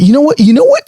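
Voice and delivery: high, eager rush of a sudden idea; mock-excited younger self getting a bad idea; excited realization. Past self hitting on a crazy plan, excited. Retold eureka moment, excited.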